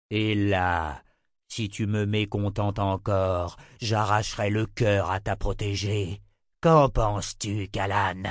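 slow, measured tyrant's threat; deliberate villain voice, savoring his power; menacing emperor. Acted. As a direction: spell out the threat with drawn-out words.

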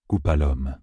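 ordinary delivery

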